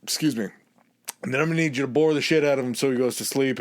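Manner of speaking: rapid orders. Retold or imitated. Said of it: "It's like".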